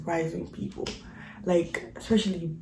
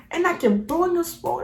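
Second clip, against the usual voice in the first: ordinary talk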